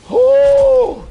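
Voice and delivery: high, loud sudden oh; surprised shout. Real, not acted. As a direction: burst out in surprise. Surprised by restock count.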